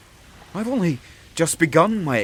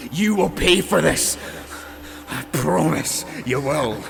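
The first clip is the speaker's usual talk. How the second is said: higher and louder vow of revenge; furious threat; 4 semitones higher, 3 dB louder than usual. Acted. The cursed king threatens the witch, furious and loud. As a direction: spit the threat at her.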